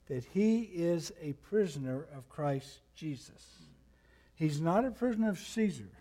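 ordinary delivery

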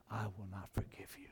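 hushed, breathy whisper of a verdict; voiceless whisper. Acted. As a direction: drop to a whisper for the judgment. Quoting the master's judgment.

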